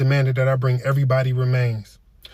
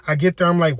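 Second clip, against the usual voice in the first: ordinary talk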